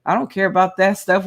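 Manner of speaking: ordinary talk, nothing marked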